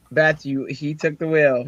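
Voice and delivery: ordinary delivery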